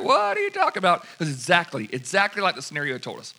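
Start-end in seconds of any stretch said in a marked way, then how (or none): 0.0-1.0 much higher, startled what; the guys answering the girl, baffled; baffled reply. Retold or imitated. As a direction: squeak out the confused question. Confused guys voiced.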